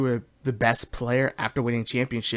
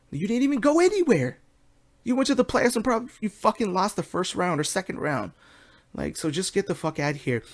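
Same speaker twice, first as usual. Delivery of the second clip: worked-up rant; 7 semitones higher than usual. Real, not acted. Ranting at Paul Pierce.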